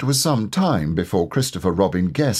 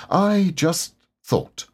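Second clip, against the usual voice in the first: slow, higher, shy little bear; lightly voiced Pooh; 9 semitones higher, much slower than usual. A narrator's reading. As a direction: Pooh timidly offering his idea.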